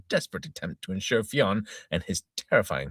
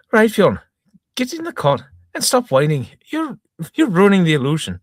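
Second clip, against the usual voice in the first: louder, higher, bossy Irish wife; 12 semitones higher, 8 dB louder than usual. Acted. Wife ordering Fionn into the cot, bossy. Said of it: "So I said".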